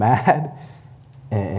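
normal talking voice

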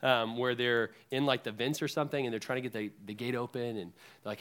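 ordinary talk, nothing marked

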